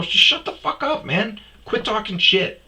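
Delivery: high-pitched, forceful telling-off; exasperated retort. Retold or imitated. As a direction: cut off the trash talk, fed up. Retold quarrel with drunk friend.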